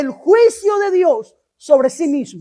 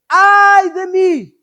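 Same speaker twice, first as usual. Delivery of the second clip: prophet's lament; 3 semitones higher, 5 dB louder, slower than usual. Acted. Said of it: "el profeta Isaías dice".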